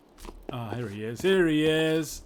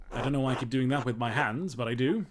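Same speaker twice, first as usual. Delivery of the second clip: ordinary talk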